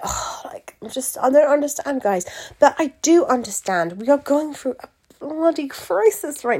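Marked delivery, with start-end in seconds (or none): 0.0-2.2 breathy sighed "oh", then higher-pitched mock complaint; mock guilt-trip. Pageant organiser imitating how she could guilt-trip followers, mock exasperation. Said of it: "make you feel guilty and be like".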